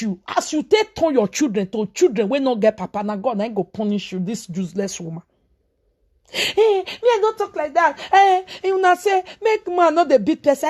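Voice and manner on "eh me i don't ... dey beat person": high, pleading outburst; exasperated outburst. Real, not acted. Ranting at women who won't bear with husbands, exasperated. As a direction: burst out, nearly crying.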